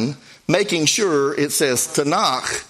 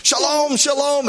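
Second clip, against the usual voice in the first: mock friendly rabbi, sung out higher; rabbi's cheerful greeting; 9 semitones higher, slower than usual. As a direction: call out a cheery shalom like the waving rabbi. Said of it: "he goes".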